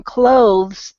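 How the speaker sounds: "clothes" with a drawn-out oh, E silent; long O. Shown as a demonstration. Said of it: "a long O sound".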